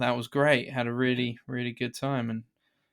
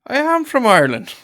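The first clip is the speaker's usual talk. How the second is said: much higher, hammed-up fake Irish accent; bad Irish accent; 10 semitones higher, 7 dB louder than usual. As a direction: overdo a cheesy Irish accent. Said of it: "the worst Irish accent I've ever heard".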